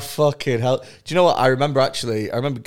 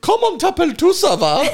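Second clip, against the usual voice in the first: shrieked, much higher and louder French; pissed-off teacher; 7 dB louder than usual. Retold or imitated. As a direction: imitate an exasperated teacher yelling bad French. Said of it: "getting more and more pissed off".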